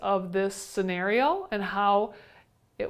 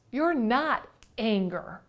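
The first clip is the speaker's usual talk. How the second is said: normal talking voice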